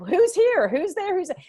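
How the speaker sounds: high, loud, baffled calling out; frantic mock confusion at the screen; confused searching.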